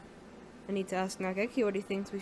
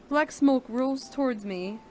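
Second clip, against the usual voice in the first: ordinary talk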